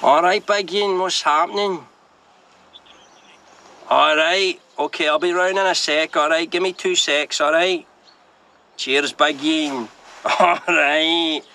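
put-on Glasgow accent for a deal. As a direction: lay on a Glasgow accent for a phone call. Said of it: "I do a Glasgow accent".

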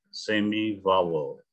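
semi vowel spelled out slowly in English; slow English demo. Shown as a demonstration. Coach modelling the term for students, slow and clear. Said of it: "Y itu semivokal".

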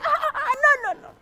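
high panicky ah ah no no; frightened protest. Acted. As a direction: squeal in mock fear. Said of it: "push each other and say".

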